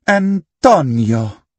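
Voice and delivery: slow, high mock-Italian name; fake Italian accent. A narrator's reading. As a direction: draw it out in a put-on Italian accent. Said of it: "in a fake Italian accent".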